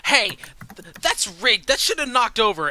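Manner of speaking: put-on high, loud whiny kid protest; bratty preteen complaint. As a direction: whine it like an indignant kid. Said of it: "and she's like".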